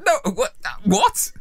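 high, disbelieving no what; mock disbelief at smokers; incredulous. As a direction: react in shocked disbelief.

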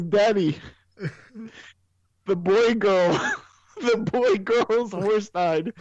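put-on high, slow little-girl voice; child voice imitated. Daughter telling her dad the horse died, childlike and high. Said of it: "She goes".

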